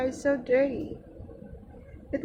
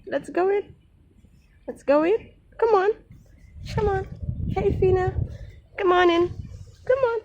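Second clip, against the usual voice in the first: coaxing pet; 7 semitones higher, faster than usual. Real, not acted. Calling the cat inside.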